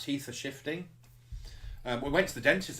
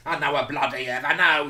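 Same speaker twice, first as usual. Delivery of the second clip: louder mock retort; character retort; 4 semitones higher, 11 dB louder than usual. Character answering back, loud mock retort.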